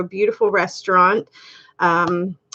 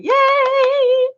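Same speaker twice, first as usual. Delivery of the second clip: excited cheer. Real, not acted. Cheering a viewer's hoped-for boy.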